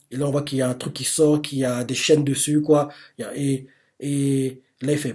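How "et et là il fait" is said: ordinary talk, nothing marked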